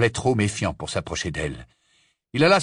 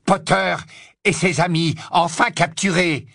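a raised, gloating announcement; 7 semitones higher, 3 dB louder than usual. Acted. Villain gloating over captured prisoners, gloating. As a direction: savour the triumph of a capture.

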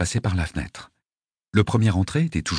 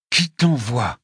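harder, more insistent questioning; forceful repeated demand; 3 semitones higher, 3 dB louder, much slower than usual. A narrator's reading. Interrogator digging fingers into a wounded attacker, demanding insistently. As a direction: press the question hard. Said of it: "répétai-je en enfonçant quatre doigts".

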